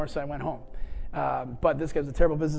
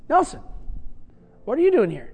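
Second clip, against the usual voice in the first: surprised; 9 semitones higher than usual. Retold or imitated. Owner surprised to see Nelson.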